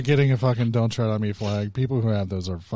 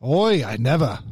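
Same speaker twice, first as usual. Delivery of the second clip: bad British accent; 2 semitones higher than usual. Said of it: "I'm not good at, like, accents".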